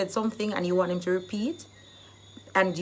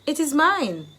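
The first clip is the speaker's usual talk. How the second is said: emphatic; 7 semitones higher, 6 dB louder than usual. Shown as a demonstration. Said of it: "English phrase".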